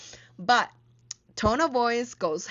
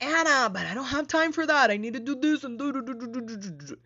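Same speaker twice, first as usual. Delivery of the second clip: rushed, higher protesting voice trailing off; mock busy listener; 5 semitones higher, much faster than usual. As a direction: play the overloaded planner brushing it off. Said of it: "I know that you're like".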